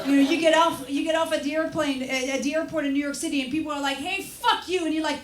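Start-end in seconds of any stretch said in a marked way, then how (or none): 4.0-4.8 high mock rude New Yorker; New Yorker snarl.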